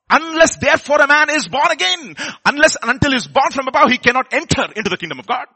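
high, loud, pressing on born again; emphatic proclamation. Real, not acted. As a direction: proclaim the verse with force. Preaching key verse forcefully.

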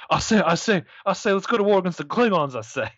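Foghorn Leghorn impression. Cohost jokes about a Southern starship captain, light Foghorn Leghorn voice. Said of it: "Foghorn Leghorn".

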